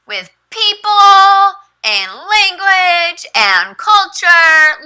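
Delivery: high, slow, carefully spaced reading; teacher voice. Shown as a demonstration. Teacher reads the slide to her young student, high and slow. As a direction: read each word out plainly for a learner.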